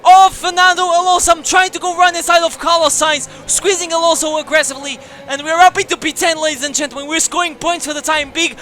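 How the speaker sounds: loud, high race-call excitement; excited commentary. Real, not acted. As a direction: call the overtake at full thrill. First-lap overtake, live commentary.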